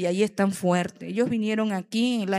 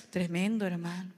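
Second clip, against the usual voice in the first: ordinary talk, nothing marked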